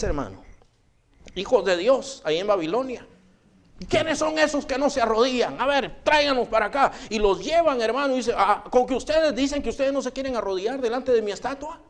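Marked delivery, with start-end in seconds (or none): 3.9-6.9 high, indignant royal demand, acted out; commanding king. King orders the kneeling refusers brought, indignant command. 8.4-11.8 high-pitched, challenging royal voice, acted; indignant king. King to three men refusing his statue, indignant.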